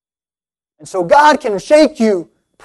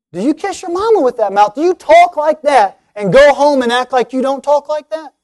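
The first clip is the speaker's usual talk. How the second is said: higher, full-voiced pointed questioning; confrontational challenge; 4 semitones higher than usual. Preacher confronting listeners about their talk, challenging. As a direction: throw the question straight at them, pitched up.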